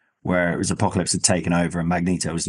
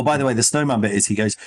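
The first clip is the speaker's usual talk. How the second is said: usual speaking voice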